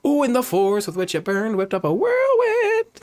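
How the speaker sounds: high, swooping excited read; hammed-up wonder. Streamer acting out game dialogue, hammed-up wonder. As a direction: build to a loud, high finish.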